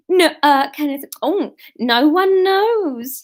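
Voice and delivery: high, slow, camp Kenneth Williams drawl. Acted. Reader redoes Mavis's line in a Kenneth Williams voice. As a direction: play Mavis as Kenneth Williams, all fluting vowels. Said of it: "oh, Kenneth Williams, of course she was".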